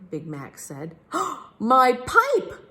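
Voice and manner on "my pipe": loud, startled outburst; shocked cry. A narrator's reading. Captain finding his lit pipe, startled cry. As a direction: cry out as you discover it. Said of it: "cried the captain".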